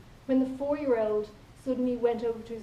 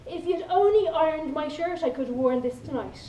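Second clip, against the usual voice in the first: mock complaint; 4 semitones higher, 6 dB louder than usual. Partner complaining about shirt.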